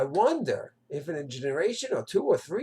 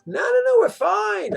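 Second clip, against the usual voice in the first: dismissive; 13 semitones higher, 6 dB louder than usual. Retold or imitated.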